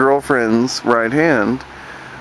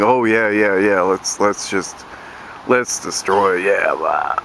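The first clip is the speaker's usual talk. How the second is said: low, lazy mock voice sinking lower; mock dopey radical; 6 semitones lower than usual. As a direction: drone it like a clueless wrecker. Mocking destructive radicals.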